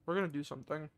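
talking with food in mouth; mouth full. Real, not acted. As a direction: say it round a mouthful. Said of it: "I'm talking to my mouth full right now".

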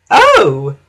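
loud, high sniffy exclamation; Elizabeth. A narrator's reading. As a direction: sniff it out loudly. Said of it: "sniffed Elizabeth".

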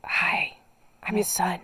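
soft unvoiced whisper; whispering on the phone. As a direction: whisper so dog sleeps. Joining the whispered call.

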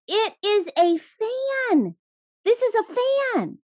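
high, slow, firmly certain; insistent blind man. Retold or imitated. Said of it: "and he says".

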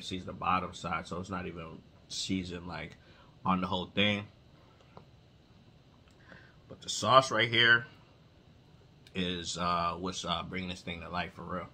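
eating between words; talking while chewing. Real, not acted. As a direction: mouth partly full. Seen 1: Bites at -1.0, chews while talking, licks fingers, bites again.

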